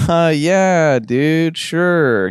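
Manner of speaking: nervous sarcasm. A narrator's reading. Damien humours paranoid Best, nervously sarcastic. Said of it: "smiling and chuckling nervously".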